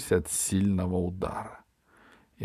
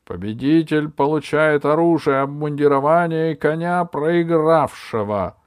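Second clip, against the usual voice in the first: loud announcement; 7 semitones higher, 8 dB louder than usual. Acted. Judge announces prize.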